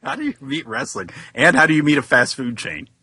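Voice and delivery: ordinary talk, nothing marked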